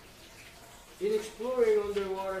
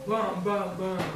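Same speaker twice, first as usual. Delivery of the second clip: loud repeated bams; thunder booms; 5 dB louder, slower than usual. Pretend. Reader imitates Mister Brown's thunder, loud booming. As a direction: boom it out like thunder. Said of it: "Mister Brown makes thunder".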